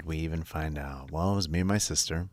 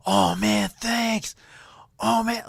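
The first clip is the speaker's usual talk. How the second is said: put-on high, loud thrilled kid; excited kid voice; 11 dB louder, slower than usual. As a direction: squeal like a delighted kid. Kid's thrilled thanks voiced.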